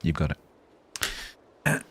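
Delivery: very slow, drawn-out and soothing; slowly reassuring Tiger he's got this; slow calm reassurance. Shown as a demonstration. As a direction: stretch it out gently, like calming someone down. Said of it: "talking very calmly".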